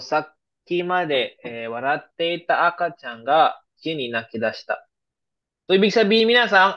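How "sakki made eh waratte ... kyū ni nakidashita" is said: slow, careful repeat of the example sentence; slow example read again. Shown as a demonstration. Teacher repeating the example sentence for students, slow and clear. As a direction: drag each phrase out so students catch it.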